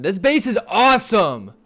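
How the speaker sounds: loud, high thrilled yelling about the base; excited shout. Real, not acted.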